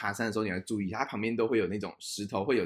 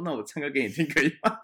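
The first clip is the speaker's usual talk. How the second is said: laughing offer; 5 semitones higher than usual. Retold or imitated. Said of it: "那我说".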